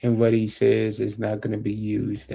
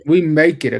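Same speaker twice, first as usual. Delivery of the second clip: louder, projected restatement; speaking up; 5 semitones higher, 9 dB louder than usual. Real, not acted. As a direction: raise the volume to be heard. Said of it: "you gotta speak up".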